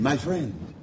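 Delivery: reach out to him with kindness; gentle. Real, not acted. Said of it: "I say to you lovingly, gently".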